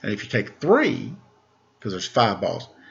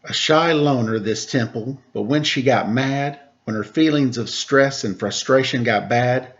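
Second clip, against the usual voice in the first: put-on bright, higher read-aloud voice for children; storybook narration; 4 semitones higher, slower than usual. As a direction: read it like a picture book to kids. Reading a picture book aloud.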